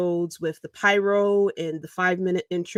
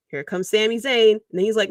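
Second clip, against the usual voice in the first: ordinary talk, nothing marked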